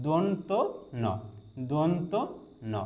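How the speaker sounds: দন্ত rising slightly, pause, then ন; teacher's piece-by-piece দন্ত ন; careful demonstration. Shown as a demonstration. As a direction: split দন্ত from ন with a clear pause. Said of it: "দেখুন এখানে উচ্চারণটা".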